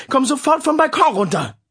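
high, loud call of the name; shout. Acted. Mother yells for her youngest, loud alarm. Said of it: "schrie Iris".

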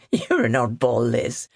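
amused, with a small laugh. A narrator's reading. Steve laughing at Liz for being an oddball, amused. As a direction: let a chuckle lead into the words. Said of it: "then laughed".

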